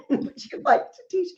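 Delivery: high, laughing mock question; high incredulous offer; mock teacher. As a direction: squeak it out like a baffled teacher. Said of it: "she's like".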